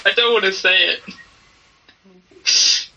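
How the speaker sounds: slow, halting reluctance; reluctant, upset. Acted. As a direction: drag the words out unwillingly. Player voices distraught crying character.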